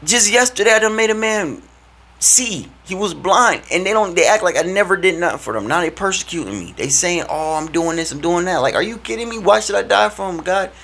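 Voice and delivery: high, exasperated complaining Jesus. Acted. Jesus grumbling to God about ungrateful people, high and exasperated. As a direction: whine it up to God like you're fed up.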